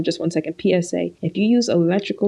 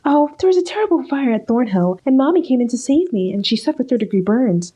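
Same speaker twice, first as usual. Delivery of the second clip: a higher, sugary fake-innocent voice; mock innocent; 6 semitones higher than usual. Imitating Cheryl lying about the fire, mock innocent. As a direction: play the innocent liar, a bit too sweet. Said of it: "And she's like".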